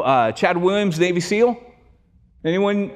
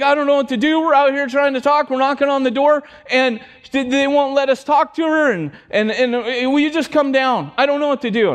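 higher-pitched, mildly frantic retold plea; upset caller; 7 semitones higher than usual. Caller begs pastor to come down, retold upset. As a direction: plead for help like a distressed caller, lightly acted. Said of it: "she sounds really upset".

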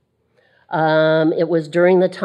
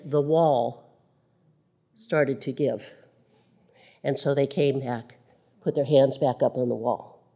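hushed telling; 5 semitones lower, 8 dB quieter than usual. A narrator's reading. Hushed climax of the vision.

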